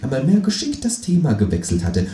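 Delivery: ordinary delivery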